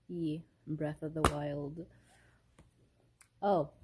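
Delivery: normal talking voice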